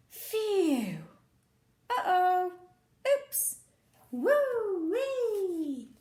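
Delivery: ordinary delivery, nothing marked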